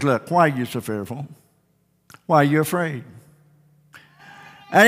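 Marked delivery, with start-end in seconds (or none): 0.0-3.0 much softer and lower, a calm tender question; gentle rebuke. Acted. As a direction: reassure frightened friends without anger. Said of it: "he didn't get up in a bad mood".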